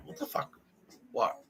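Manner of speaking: hushed unvoiced "what the fuck"; whispered disbelief. Whispering confusion at colleagues acting weird. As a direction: barely voice it, baffled.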